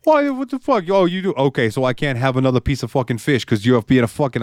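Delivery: high, whiny New York wise guy; hammed-up high-pitched complaining; extra annoying penguin. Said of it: "being extra annoying".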